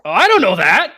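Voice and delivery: high, loud alarmed cry; panicked guard. Acted. Stumped bridge guard, panicked outburst. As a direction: blurt it out loudly in mock panic.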